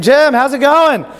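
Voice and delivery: high, drawn-out call; mock yell down. Friend on cliff to Jim on river, mock calling out. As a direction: stretch it out to reach someone below. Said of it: "You just yell down".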